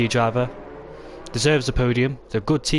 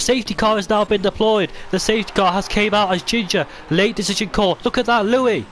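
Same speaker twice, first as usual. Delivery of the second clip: excited; 7 semitones higher than usual. Acted. Safety car surprise call.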